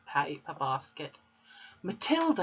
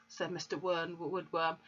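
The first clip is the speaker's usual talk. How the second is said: usual speaking voice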